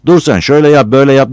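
high, loud mock bossy voice; mocking headman's orders. Battal mocks the headman's bossing, high and loud. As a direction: ape the headman giving orders. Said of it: "diyecek olursa".

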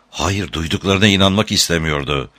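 plain reading voice

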